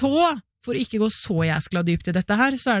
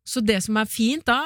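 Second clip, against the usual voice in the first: usual speaking voice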